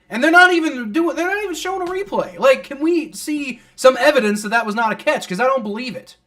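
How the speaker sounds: high, loud indignant protest; incredulous at the officials; real fan outrage at missing replay; exasperated rant. Real, not acted. Fan ranting about no replay.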